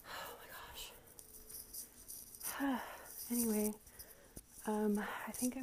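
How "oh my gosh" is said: breathless whisper. Real, not acted. Winded after cleaning, exhausted.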